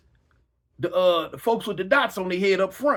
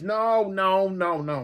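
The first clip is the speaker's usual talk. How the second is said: normal talking voice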